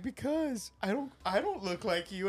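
dad mimicking his teenage daughter's whine; high mock teen voice.

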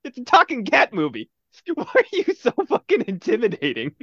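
high-pitched laughing disbelief; hammed-up bewilderment through laughs; incredulous reaction. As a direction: protest in a high, baffled voice. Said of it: "You're just like".

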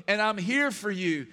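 fervent pulpit preaching, rising and loud; full-force preaching. Acted. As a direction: preach it out to the whole room.

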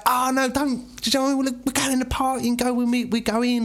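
a hint of the cheery oblivious stepdad; high breezy dad voice. Stepdad voiced in retold story.

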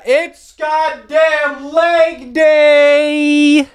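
very high, long drawn-out holler; exaggerated high-pitched gym war cry; hammed-up hype. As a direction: shriek it like an over-the-top announcement, stretching every word. Suddenly hypes up the gym day.

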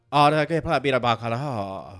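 hammed-up clumsy Spanish of the film's Latinos; poking fun at stilted movie Spanish; mock bad Spanish. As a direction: parody the awkward dialogue, mock accent. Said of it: "el diálogo es".

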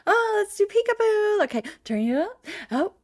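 high, sing-song peekaboo play voice; hammed-up baby-talk excitement; over the top. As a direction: imitate playing with a baby. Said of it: "at loud and over the top".

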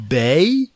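"Bay" as a high, hesitant question; stretched, rising "Bay"; child sounding out. Retold or imitated. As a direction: guess "Bay" like a new reader. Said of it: "she's like".

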